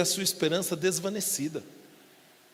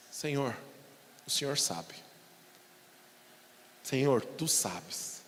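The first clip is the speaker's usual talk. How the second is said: humble surrender; 4 semitones lower, 7 dB quieter, slower than usual. Acted.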